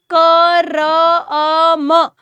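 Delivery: कर्म drawn out sound by sound, "क र अ म"; slowly sounded out. Shown as a demonstration. Teacher breaking कर्म apart for pupils, slowly sounded out. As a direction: stretch "क र अ म" very slowly, each sound held. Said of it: "कर्म को धीरे धीरे बोलो".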